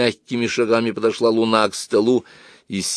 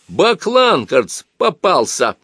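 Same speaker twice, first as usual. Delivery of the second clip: excited, raised realisation; догадка; 8 semitones higher, 5 dB louder, slower than usual. A narrator's reading. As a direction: announce the catch, loud and high. Тетеркин понял, что вор пойман. (возбуждённо, громко)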